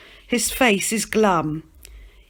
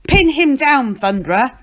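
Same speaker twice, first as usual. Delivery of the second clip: pin-him-down call, much louder; a fairgoer shouting at the wrestling booth, loud; 6 semitones higher, 7 dB louder than usual. Acted. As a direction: bellow it over the crowd. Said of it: "call out in a loud voice".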